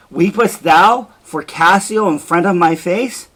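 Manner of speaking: a husband confronts his weeping wife, enraged; jealous rage. Acted. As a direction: hurl it at her in jealousy. Othello rages at weeping wife.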